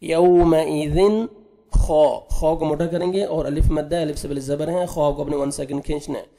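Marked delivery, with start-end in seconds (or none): 0.0-1.2 यौमइज़िन recited slowly, drawn out; Quranic recitation. Shown as a demonstration. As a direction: chant यौमइज़िन with tajweed. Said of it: "जल्दी पढ़ेंगे". (यौमइज़िन तजवीद के साथ धीरे)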